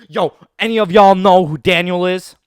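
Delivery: loud shout to the whole group; rally the gang, projecting hard; calling out. Retold or imitated. Boy at a war meeting asking his friends, calling out.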